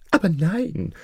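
high-pitched vizier denial; scheming vizier. Acted. Vizier calms the shocked king, scheming. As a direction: deny it in a high character voice.

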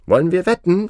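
high, loud challenge; eager Englishmen. Acted. Englishmen ask the farmer to wager, eager and high.

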